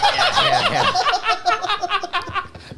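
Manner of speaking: long, high-pitched laughing fit; laughter. Real, not acted. Cracking up at a crude joke.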